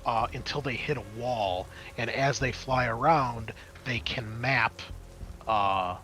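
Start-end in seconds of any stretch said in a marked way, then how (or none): none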